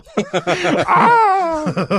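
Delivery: high shrieking laughter. Real, not acted. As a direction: burst out shrieking. Laughs at the owl call.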